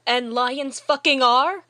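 loud indignant disbelief; exasperated outburst. Acted. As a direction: blow up at a ridiculous question. Exasperated retort in acted argument.

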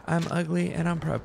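high-pitched cartoon quote; SpongeBob. Streamer repeating a cartoon quote, high proud impression. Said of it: "As SpongeBob once said".

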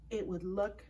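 ordinary delivery, nothing marked

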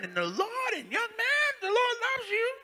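put-on high, loud churchy old lady voice; shrill-free but high and loud street preaching lady; church lady yelling. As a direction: holler it like a fervent church mother.

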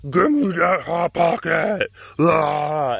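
hammed-up greedy beast, ending in a roar; mean big-cat voice. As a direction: snarl it like a cat grabbing food. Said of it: "And she got mean".

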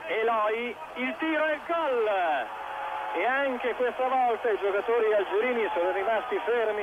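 goal call, pitch soaring high; excited goal call. Real, not acted. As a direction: shout the goal with rising joy. Goal call in live commentary. (telecronaca esultante per il gol)

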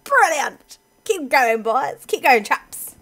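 loud, hammed-up posh English cheer; rally them in a booming posh English accent; going posh. Player urging the boys on, mock posh. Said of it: "it makes me wanna drop posh".

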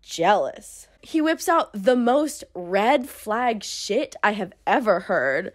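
ordinary delivery

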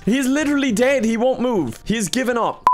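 high-pitched exasperation; exasperated protest. Real, not acted. Gamer complains his robot won't move, high exasperation. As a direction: protest in high, helpless disbelief.

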